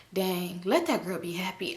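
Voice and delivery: a private inner scold under the breath; quiet self-talk. Retold or imitated.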